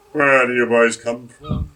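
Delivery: a lightly voiced policeman, official tone; policeman's voice. A narrator's reading. Policeman questioning the drunken outing, lightly official voice. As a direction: ask it like a mild village constable. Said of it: "in his button blue voice".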